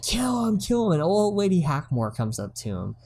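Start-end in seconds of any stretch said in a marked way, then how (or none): 0.0-0.9 high, hammed-up kill order; mock mayor. Mayor yelling to kill the troll, high mock demand. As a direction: egg on the crowd to kill.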